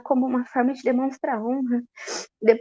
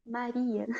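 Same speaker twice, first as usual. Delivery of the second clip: quiet, gentle utterance of "Maria"; soft tender naming; 11 dB quieter than usual. Acted. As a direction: say "Maria" very softly, falling at the end. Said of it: "Jesus disse". (chamado suave, bem baixinho)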